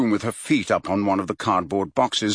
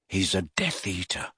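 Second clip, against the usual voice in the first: softer, spelled-out slowly; slow, quiet realization; 2 semitones lower, 7 dB quieter than usual. A narrator's reading. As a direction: let the dread dawn word by word. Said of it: "said Harry slowly".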